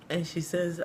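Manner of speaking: usual speaking voice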